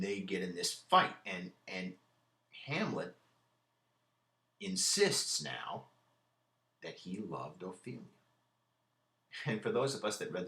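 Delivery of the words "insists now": insists now stretched slow and pitched much higher; drawn-out emphasis. Shown as a demonstration. Lecturer drawing out insists now for the class. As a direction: lean hard on insists now, slowly. Stressing Hamlet's sudden claim.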